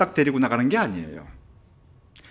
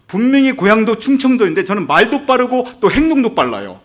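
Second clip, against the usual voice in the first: usual speaking voice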